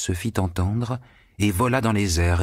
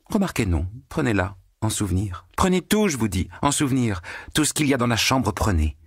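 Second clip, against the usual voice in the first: a hint of carefree generosity, pitched higher; lightly voiced Margarita; 6 semitones higher than usual. A narrator's reading. Margarita giving her things to Natasha, lightly carefree. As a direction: toss it all away lightly.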